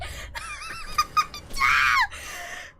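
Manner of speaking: a high shrieking burst of laughter. Real, not acted. Streamer laughing at his own mishap, shrieking laugh.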